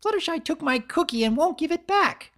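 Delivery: put-on high, loud tattletale voice, like a pouting child; whiny tattling complaint. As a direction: tell on her to Twilight, up high and loud. Pinkie complaining to Twilight.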